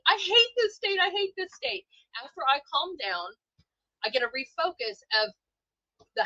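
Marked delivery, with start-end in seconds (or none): 0.1-1.8 high, loud mock scream of frustration; yelling I hate this state, high and loud; retold screaming. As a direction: shout it like you are fed up.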